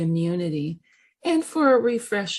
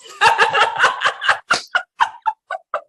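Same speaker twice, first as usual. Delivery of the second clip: high laugh; 14 semitones higher, 5 dB louder than usual. Real, not acted. Laughing at own punchline.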